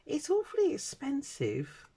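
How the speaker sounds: high, slow mock-posh voice; posh complaint. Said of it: "And I said to her".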